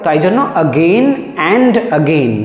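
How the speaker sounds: normal talking voice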